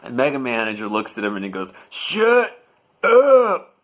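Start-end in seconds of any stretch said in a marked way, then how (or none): 1.9-3.7 very high, very loud shout; top-of-lungs yell. Retold or imitated. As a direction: scream it at the top of your lungs. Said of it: "Yells it at the top of his lungs".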